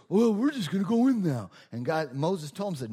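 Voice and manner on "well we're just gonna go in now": higher, louder brash voice; cocky Israelites. Acted. Preacher mimicking the rash Israelites, high and loud. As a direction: toss it off like overconfident fools.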